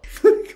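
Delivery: sudden high mocking laugh; burst of laughter. Real, not acted. Mocking a rival, a loud burst of laughter.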